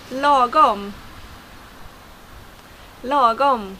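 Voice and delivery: lagom with a long first vowel; Swedish lagom. Shown as a demonstration. Teacher introducing a word only in Swedish, lagom pronounced. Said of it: "a word that I think only is in Swedish".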